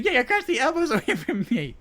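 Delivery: high, laughing mock complaint; half-laughing mock of an annoyed girl; imitating Abby. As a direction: whine it at him while cracking up. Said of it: "She said".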